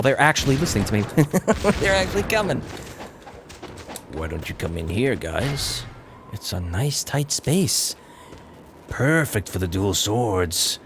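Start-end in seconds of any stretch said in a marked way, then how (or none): 4.1-10.8 coaxing, softened lure; mock enticing. Player luring enemies into a tight space, coaxing. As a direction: draw them in slowly at the end.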